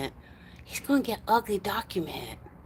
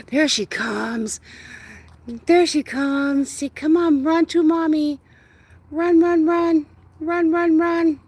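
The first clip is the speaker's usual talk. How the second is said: high, loud, slow coaxing of a dog; excited high calls to the dog; 9 semitones higher, 7 dB louder, slower than usual. Real, not acted. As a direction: cheer the dog on toward Mommy. Calling dog back to her.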